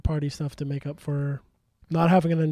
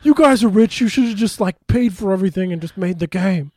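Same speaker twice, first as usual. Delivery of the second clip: mock media outrage; 3 semitones higher, 6 dB louder than usual. Games media reacting to Microsoft, mock outrage. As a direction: imitate an outraged games press. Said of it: "riots from games media".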